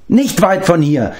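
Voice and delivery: a pitiful little creature complaining; whining beast-man. Acted. Gurgi complains he gets no thanks, high whining creature voice.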